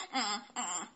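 muffled munching grunts; mouth full. A narrator's reading. Chick caught eating, mouth-full mumble. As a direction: mumble through a stuffed mouth. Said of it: "贝利奥嘴里塞得满满的".

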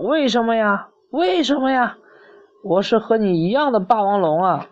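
high, hurt storybook plea; protesting Tyrannosaurus. Acted. Bitten dinosaur protests in the story.

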